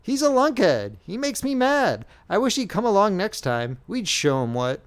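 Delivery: high, exasperated outburst; sudden exasperation. A narrator's reading. Youth venting about the general, exasperated. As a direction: burst out, fed up. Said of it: "exclaimed with sudden exasperation".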